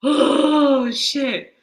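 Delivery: high, breathless laughing voice; overcome with laughter. Real, not acted. Host apologising to viewers, still laughing. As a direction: still reeling from the joke. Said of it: "That was just too much for me".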